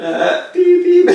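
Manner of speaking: high, loud laughing beeps; high beep-beep, playing the line dead; fake phone beeps. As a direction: pretend the line is dropping. Faking phone cutting out.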